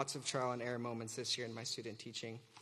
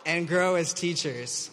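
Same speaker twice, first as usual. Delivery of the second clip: slow, louder, heavily sarcastic; 3 semitones higher, 8 dB louder, slower than usual. Acted. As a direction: drag it out with mock enthusiasm. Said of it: "I'm being very sarcastic".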